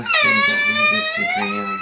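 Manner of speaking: squeaky put-on question voice; husband teasing in a falsetto; high mock voice. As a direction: ask it in a silly high voice.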